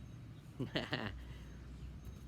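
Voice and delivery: soft, voiceless chuckle; laugh. Real, not acted. Reader amused by the glued-mouth joke, soft laugh. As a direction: chuckle softly at your own silly act. Laughs at own muffled act.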